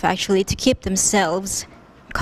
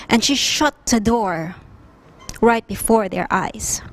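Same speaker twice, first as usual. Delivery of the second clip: usual speaking voice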